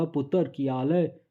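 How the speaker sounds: put-on light Punjabi accent for a friendly greeting; a hint of a cheerful Punjabi uncle. Teacher giving students an example of a Punjabi accent, lightly done. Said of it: "तो पंजाबी कैसे बोलता है".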